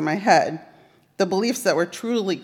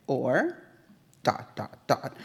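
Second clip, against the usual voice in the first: a soft, trailing "or" left hanging; hushed therapist prompt; 5 semitones lower, 9 dB quieter, slower than usual. Retold or imitated. Therapist gently prodding her client, soft and trailing off. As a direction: let the word hang open quietly, waiting. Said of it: "And my therapist would say".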